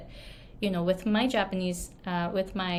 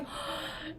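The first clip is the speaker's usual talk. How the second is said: mock gasp; 11 dB quieter than usual. Shocked tutor reaction.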